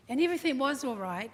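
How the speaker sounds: ordinary delivery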